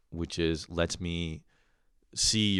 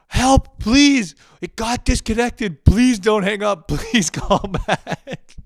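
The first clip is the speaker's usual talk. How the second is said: much higher, louder, slow begging voice; frantic plea; 14 semitones higher, 10 dB louder, much slower than usual. Retold or imitated. Customer typing to tech support: loud, high mock begging. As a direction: beg desperately, dragging it out. Customer types panicked message.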